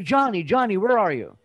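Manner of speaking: acted parent calling for a child. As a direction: call through the house for Johnny, as a joke-teller would. Dad searching house calls out.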